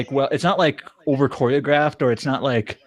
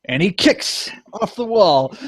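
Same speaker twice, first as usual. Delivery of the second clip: slow, higher-pitched dramatic narration; cheesy narrator voice; 5 semitones higher, slower than usual. Retold or imitated. Fan imitating the show's narrator, cheesy announcer voice. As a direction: draw it out like a hammy announcer. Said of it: "kind of this cheesy voice".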